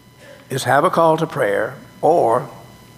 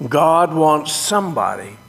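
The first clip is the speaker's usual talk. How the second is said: ordinary talk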